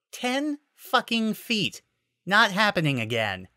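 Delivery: high, slow indignant complaint. Acted. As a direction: stretch it out in outrage.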